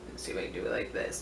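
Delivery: ordinary talk, nothing marked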